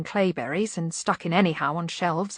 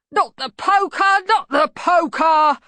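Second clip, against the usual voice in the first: loud, high, drawn-out begging; panicked bogeyman yell, stretched out; panicked plea; 10 semitones higher, 7 dB louder, slower than usual. A narrator's reading. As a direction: cry out in terror of a beating. Bogeyman begs, threatened with poker.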